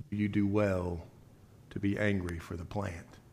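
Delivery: quiet God voice. Acted. God quietly questioning Jonah's anger, soft and calm.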